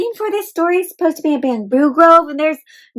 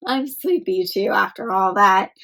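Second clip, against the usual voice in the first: plain reading voice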